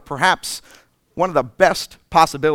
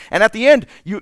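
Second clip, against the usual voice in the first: ordinary talk, nothing marked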